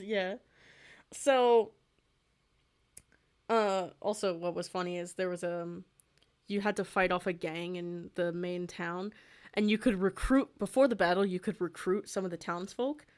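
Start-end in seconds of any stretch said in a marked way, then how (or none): none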